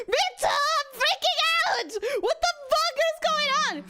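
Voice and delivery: high frantic yell; freaking out. Acted. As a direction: shriek it out in a high rush. Character freaking out.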